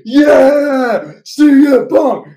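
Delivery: high, loud crowd cheer; imitated arena cheer, drawn out; cheering fans. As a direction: yell it like an ecstatic fan.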